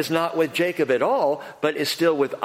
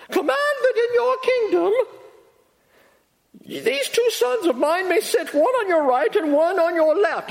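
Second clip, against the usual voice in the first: much higher mock British lady voice; British accent. Mother of James and John asking Jesus, in a high mock British accent. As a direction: put on a lofty British accent for the pleading mother. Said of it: "I don't know why I'm going British there".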